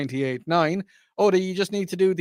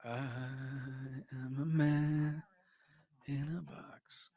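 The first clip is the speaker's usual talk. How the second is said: whisper; 2 semitones lower, 16 dB quieter, slower than usual. Acted. Odd muffled aside.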